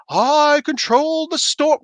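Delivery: slow, lofty high proclamation; mock regal Storm line; grand slow Storm voice. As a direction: declaim it like a regal heroine. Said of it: "she talks like".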